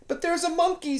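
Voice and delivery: put-on a small boy protesting eagerly; insistent boy. Boy at story hour insisting there's a monkey, high and eager. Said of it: "said a boy".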